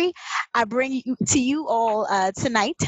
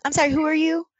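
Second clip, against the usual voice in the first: a touch higher, puzzled; mild mock bewilderment; mock confusion; 4 semitones higher, much faster than usual. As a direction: act not to recognise yourself in the mirror. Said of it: "looking at their quarantine bay like".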